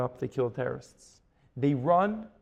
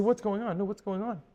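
higher-pitched worried questions; alarmed questioning; 6 semitones higher than usual. Retold or imitated. Diners to the crying Rebbetzin, alarmed questioning. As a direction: imitate bewildered onlookers asking urgently.

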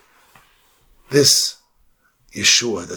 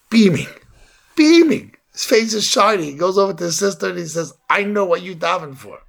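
high, loud burst of delight; excited storytelling; 8 semitones higher, 6 dB louder than usual. A narrator's reading. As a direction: tell it beaming with a child's excitement. Boy comes home overjoyed.

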